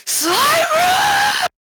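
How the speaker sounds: high, loud furious yell; angry shout. Acted. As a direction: scream her name in anger. Character yells at companion.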